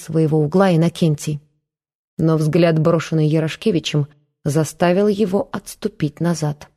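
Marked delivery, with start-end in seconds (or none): none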